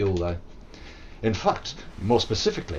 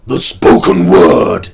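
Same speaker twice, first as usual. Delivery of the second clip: much louder growled speech; low growled death metal speech; 6 semitones lower, 14 dB louder, much slower than usual. Shown as a demonstration. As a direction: push the words into a metal growl. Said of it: "push into a death metal voice".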